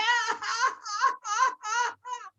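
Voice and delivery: very high squealing laugh; high whooping laugh; shrieking laugh. Pretend. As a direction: let out a wild shrieking cackle.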